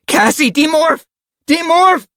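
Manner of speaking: very high, urgent cry; screamed plea. A narrator's reading. Narrator urges Cassie to change back, screaming. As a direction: cry out high without much volume. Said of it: "I screamed".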